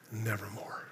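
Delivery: the raven's last hopeless whisper. Acted. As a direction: let the raven's answer die to a hush. Raven answers the grieving poet.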